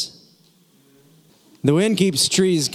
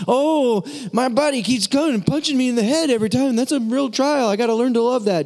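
much higher, fast, mocking voice of a naive believer; mock the wrong reading, pitched way up and rushed; mock misreading; 8 semitones higher, faster than usual. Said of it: "James says".

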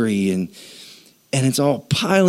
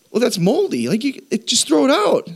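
much higher, incredulous voice; mock exasperated; 12 semitones higher than usual. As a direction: play the fed-up viewer, pitched way up. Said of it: "and you're going".

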